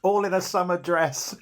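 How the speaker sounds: laughing. Real, not acted. Both crack up at the story.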